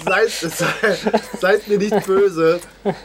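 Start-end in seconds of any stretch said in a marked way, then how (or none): none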